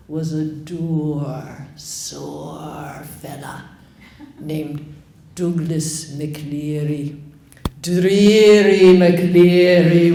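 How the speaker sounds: very slow, low, drawn-out and grim; dour old crone. Acted. Old woman describing the sheriff, drawn-out and grim. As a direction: savour each gloomy word.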